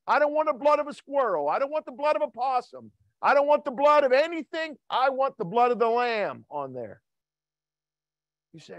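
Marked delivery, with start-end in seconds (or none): 0.1-7.0 high, driving preacher cadence; fervent sermon build; preaching at full force. Acted. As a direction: hammer each line home from the pulpit.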